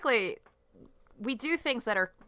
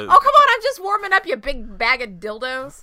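excited high taunt; 5 semitones higher, 11 dB louder than usual. Pretend. Teasing taunt.

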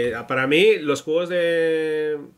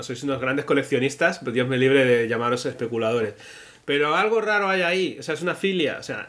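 normal talking voice

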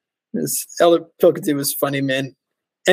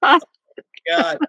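ordinary delivery

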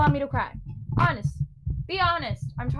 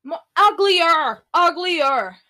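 much louder, slow exclamation; exclaiming; 5 semitones higher, 9 dB louder, slower than usual. Real, not acted. Vlogger correcting herself loudly: exclaimed uglier. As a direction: belt out uglier. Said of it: "I look ugly".

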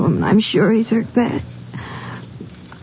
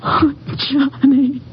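breaking down; 5 semitones higher, much slower than usual. Acted. Girl mourns dead fiancé.

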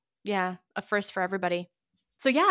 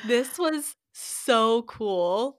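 slow, drawn-out excitement; excited; 3 semitones higher, 4 dB louder, much slower than usual. Real, not acted. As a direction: stretch it out with excitement. Recalling the balloon ride.

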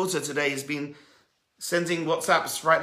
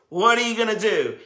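challenging question; 7 semitones higher, 6 dB louder than usual. Retold or imitated. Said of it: "It's Hashem saying to you".

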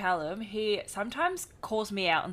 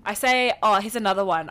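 usual speaking voice

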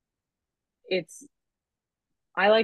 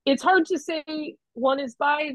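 normal talking voice

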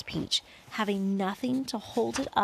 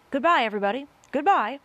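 loud, higher-pitched goodbye; calling farewell; 5 semitones higher, 6 dB louder, faster than usual. A narrator's reading. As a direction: call out a last farewell. Strangers' last farewells before the crash.

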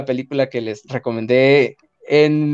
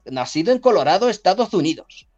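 presentador más agudo y fuerte; dramatic announcer; 8 semitones higher than usual. Acted. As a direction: anuncia el caso con tono teatral. Anuncia el caso teatralmente. (voz de anunciador de misterio)

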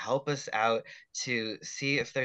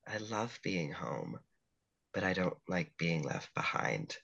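sad Beth; 3 semitones lower than usual. Acted. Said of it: "It's so sad".

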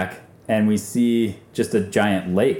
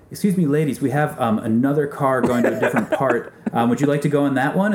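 higher, mock-courteous voice; sham politeness; 4 semitones higher than usual. Guide coaxing two ladies out of the van, falsely polite. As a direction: sweet-talk them with exaggerated politeness.

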